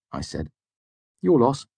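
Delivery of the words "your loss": higher, offhand absent remark; absent-minded. A narrator's reading. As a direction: toss it off while busy reading. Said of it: "Sim said absently".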